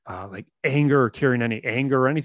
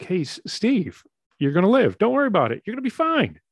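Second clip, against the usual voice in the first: higher, louder, breezy doctor voice; upbeat doctor; 10 semitones higher, 4 dB louder, faster than usual. Retold or imitated. Doctor on the phone telling Steve he'll live, breezy and upbeat. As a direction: play the cheery doctor reassuring him. Doctor calls with good news.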